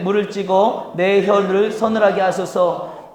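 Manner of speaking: slow, deliberate scripture reading; slow unison reading. Shown as a demonstration. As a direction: read the verse together, slowly. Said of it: "시작". (천천히 함께 봉독하는 성경 읽기)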